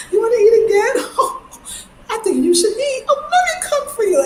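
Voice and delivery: high, laughing coaxing voice; laughing Trini auntie. Retold or imitated. Trini host urging a thin guest to eat, high and laughing. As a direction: coax her to eat, way up high and giggling. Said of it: "They just like".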